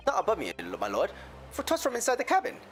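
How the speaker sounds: a lilting Irish brogue; Irish accent. Acted. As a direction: tell it like an Irish witness. McGilded in accent.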